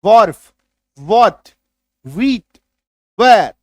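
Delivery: wharf, what, wheat, where each said loudly on व. Shown as a demonstration. Teacher drills WH words, said loudly one by one. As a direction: punch each WH word with a clear व. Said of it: "आ रही है बात समझ में".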